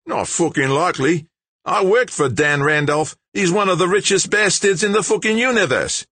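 loud, high, scoffing outburst; blurted disbelief. A narrator's reading. Big George refusing to believe the stranger, blurting loudly. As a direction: build to a loud, high protest. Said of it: "blurted Big George".

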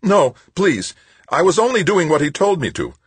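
very high, loud, panicked begging; frantic high-pitched excuse; terrified pleading. A narrator's reading. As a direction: plead for your life in a high voice. Terrified psychologist pleads with attacker.

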